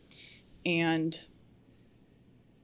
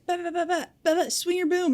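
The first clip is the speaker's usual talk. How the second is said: much higher babbling bark of an order; mock yelling foreman; 13 semitones higher than usual. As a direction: imitate a pushy boss barking orders. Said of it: "he's like".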